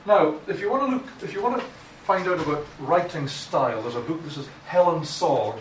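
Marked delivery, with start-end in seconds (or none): none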